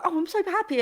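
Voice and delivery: high, gushy burst of happiness; mock happy. Guest imitating her own mood swings, mock happy. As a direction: squeal it lightly, acting out joy. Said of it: "one minute I'm on the surface".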